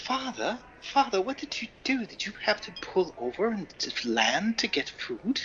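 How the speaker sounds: put-on high, breathless old-fashioned boy; eager boy pleading with his father; old-timey voice. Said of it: "give him an old-timey voice".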